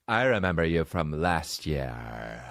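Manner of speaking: low, slow villain voice with a drawn-out end; evil scientist. Retold or imitated. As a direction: play a flamboyant mad scientist plotting world takeover. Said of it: "Like he was an evil scientist".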